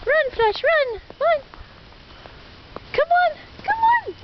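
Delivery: high, urgent calls to the dog; excited calling. Real, not acted. Calling dog to run in snow.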